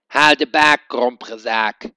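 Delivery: loud squawking parrot call, slow at the end. A narrator's reading. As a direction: screech it like a cheeky parrot.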